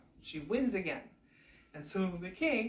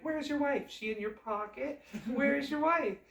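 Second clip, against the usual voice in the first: high taunting sing-song; mocking brothers; 7 semitones higher, 4 dB louder than usual. Brothers mock the son without a wife, high taunting. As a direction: tease him mercilessly.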